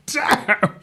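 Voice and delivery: normal talking voice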